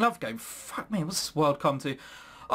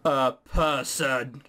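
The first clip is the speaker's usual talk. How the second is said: slow, hushed mock horror; mock dread; 6 semitones lower, much slower than usual. Player sees the human captor, slow mock dread. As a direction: drag it out in fake fear.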